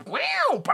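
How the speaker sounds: high vocal sound effect of music kicking in; imitated music restart. Mimicking the music jumping back in, high swooping noise. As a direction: mimic the beat crashing back. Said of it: "it jumps back into the music".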